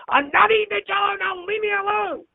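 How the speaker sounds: spring up and squeal the refusal at speed; sudden burst of energy. Retold or imitated. Dying father sits up and refuses his wife, high and fast. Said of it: "with as much energy as I'd seen him have in weeks".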